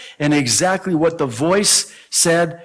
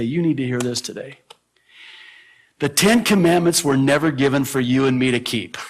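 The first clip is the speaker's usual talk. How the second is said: ordinary talk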